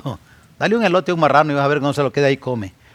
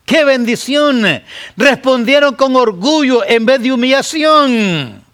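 high, loud, ironic cry; preaching exclamation; 11 semitones higher, 6 dB louder than usual. Acted. Preacher scolding the proud congregation, loud and high. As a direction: proclaim it with sharp irony.

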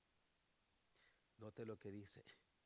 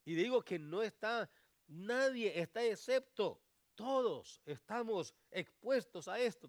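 loud, much higher preaching; preaching at full force; 8 semitones higher, 14 dB louder than usual. Acted. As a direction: urge the parents with full force.

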